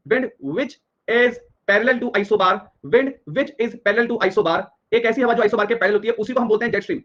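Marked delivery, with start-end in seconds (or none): 0.1-4.6 very slow, word-by-word definition; slow dictation. Real, not acted. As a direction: spell the definition out slowly, phrase by phrase. Spells out the definition slowly. (धीरे-धीरे, एक-एक शब्द बोलकर)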